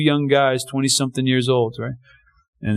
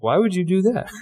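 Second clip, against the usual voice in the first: higher-pitched, puzzled surprise; mock-astonished question, pitched up; surprised; 4 semitones higher than usual. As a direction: ask in retold disbelief. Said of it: "Like, I was surprised".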